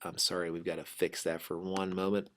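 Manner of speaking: ordinary talk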